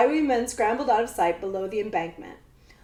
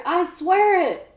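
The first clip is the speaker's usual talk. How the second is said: pleading; 8 semitones higher, 5 dB louder, slower than usual. A narrator's reading. Boy pleads.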